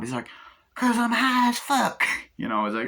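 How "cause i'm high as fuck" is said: higher, louder, slow stoned drawl; high slow stoner line; stoned actor impression. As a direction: stretch it out, proudly baked. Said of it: "doing the impressions of the, uh, actors".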